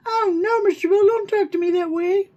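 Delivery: high squeaky cartoon cry; Mr. Bill voice. Comic quote in a high squeaky cartoon voice. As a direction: squeal it like a frightened clay figure.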